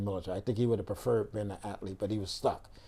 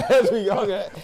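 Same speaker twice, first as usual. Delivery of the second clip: amused disbelief; 9 semitones higher, 8 dB louder than usual. Real, not acted. Recalling shock, still amused.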